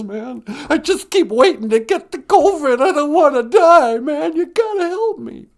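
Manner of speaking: high, loud pleading; terrified lion caller. Acted. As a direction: beg for help in a panic.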